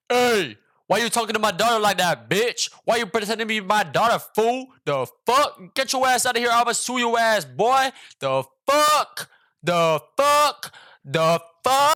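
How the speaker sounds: high, loud, enraged shouting at the phone; furious dad yelling. Retold or imitated. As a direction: imitate an outraged father screaming down the line. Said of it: "he was pissed as hell".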